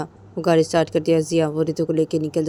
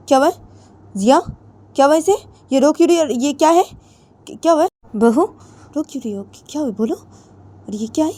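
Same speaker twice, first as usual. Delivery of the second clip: alarmed mother-in-law; 7 semitones higher, 5 dB louder than usual. Acted. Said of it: "जिया की mummy ... बोलती कि".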